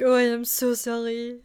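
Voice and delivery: put-on thick French accent. Retelling the late guy's apology, thick French accent. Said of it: "he has a really thick French accent".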